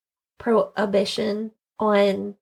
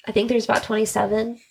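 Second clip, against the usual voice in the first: usual speaking voice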